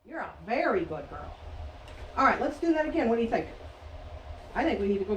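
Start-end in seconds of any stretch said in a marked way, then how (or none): 0.0-1.3 soft praise. Real, not acted.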